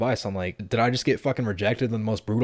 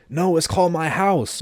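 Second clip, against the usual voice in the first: louder, higher, slow teasing yell; yelling back; 8 semitones higher, 6 dB louder, slower than usual. Retold or imitated. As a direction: shout it back, trolling her. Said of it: "And I yell back".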